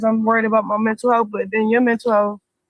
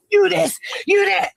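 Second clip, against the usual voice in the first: mock angry yell; 6 semitones higher than usual. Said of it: "he be like".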